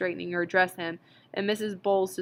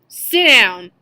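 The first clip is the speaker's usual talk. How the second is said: loud shout; 3 semitones higher, 17 dB louder than usual. A narrator's reading. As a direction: roar it, much louder. Montag shouts at the women.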